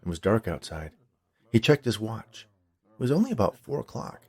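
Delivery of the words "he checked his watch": usual speaking voice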